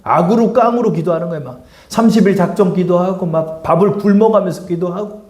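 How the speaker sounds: ordinary delivery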